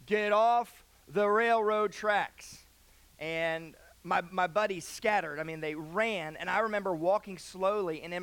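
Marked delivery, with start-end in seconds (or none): 0.1-2.6 slow, loud booming command; drawn-out authoritative shout; booming megaphone voice. Retold or imitated. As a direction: boom it out slowly like police on a megaphone. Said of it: "we hear this booming voice".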